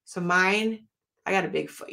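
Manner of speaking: ordinary talk, nothing marked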